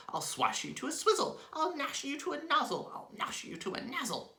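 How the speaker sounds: very high furious hag's threat; raging shout. Acted. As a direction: spit the threat in fury. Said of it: "she sh-, shouted".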